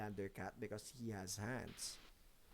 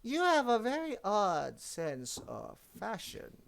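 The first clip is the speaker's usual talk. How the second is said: slow, higher, snooty cat; haughty cat voice; 7 semitones higher, slower than usual. Acted. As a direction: look down your nose at him. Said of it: "he says".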